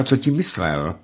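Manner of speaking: usual speaking voice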